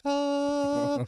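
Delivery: high, breathy "Oh" of awe; awed gasp. Retold or imitated. Stunned by the fancy office, a high awed oh. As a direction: gasp at the luxury.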